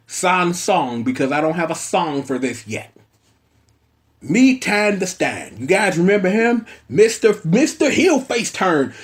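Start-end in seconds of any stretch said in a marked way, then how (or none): none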